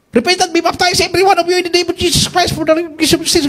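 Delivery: high, ringing preacher's call; delirious preaching. Retold or imitated. Delirious near death, he preaches in English in a high ringing call. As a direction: proclaim it like a fevered evangelist. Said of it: "Puro English hanggang nawala ako".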